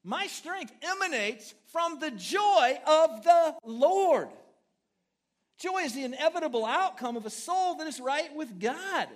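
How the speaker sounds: loud, high, slow proclamation from the pulpit; booming sermon opening; full-force preaching. Acted. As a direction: preach it out big to the whole room.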